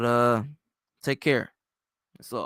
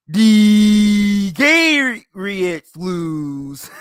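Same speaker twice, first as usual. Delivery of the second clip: drawn-out, loud announcing call with a laugh; imitating his buddy's big gloating holler; friend impression; 4 semitones higher, 9 dB louder, much slower than usual. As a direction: stretch each word out long and high, then cackle. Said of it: "That's how he sounds, by the way".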